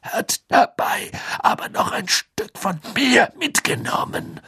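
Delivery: raues Flüstern; bitter raunen; geflüstert. Acted. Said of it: "flüsterte Moody". (Moody flüstert bitter über seine Wunde)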